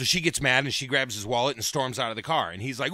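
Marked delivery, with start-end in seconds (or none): none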